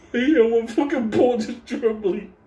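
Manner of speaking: high, loud, laugh-choked words; cracking up. Real, not acted. Storyteller describing the dog's trembling paw, cracking up. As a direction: push the words out through laughter.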